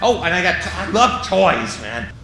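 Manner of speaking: normal talking voice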